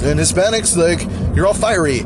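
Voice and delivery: mock a hacky comic's delivery; lightly exaggerated mockery; mocking comedian bit. Mimicking stereotype racial comedians.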